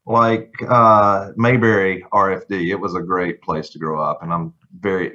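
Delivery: usual speaking voice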